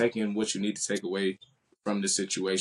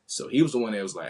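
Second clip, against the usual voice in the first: ordinary talk, nothing marked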